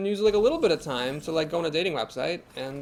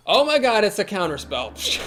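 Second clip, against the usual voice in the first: loud, higher-pitched outburst of surprise; surprised; 4 semitones higher, 6 dB louder than usual. Real, not acted. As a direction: blurt it out as the spell gets countered. Opponent's counterspell catches him off guard.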